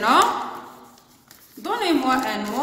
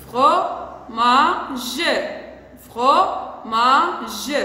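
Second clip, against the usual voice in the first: "Fromage" broken into fro, ma, ge; syllable by syllable; much slower than usual. Shown as a demonstration. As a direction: sound out "Fromage" with gaps, voicing the final e. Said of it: "on a combien de syllabes?". (« Fromage » découpé en trois syllabes, fro-ma-ge)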